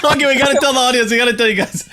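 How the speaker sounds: laughing through the words, pitch jumping up. Real, not acted. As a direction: crack up while trying to get it out. Host cracks up at the story.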